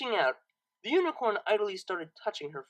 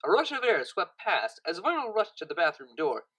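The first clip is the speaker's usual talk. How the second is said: usual speaking voice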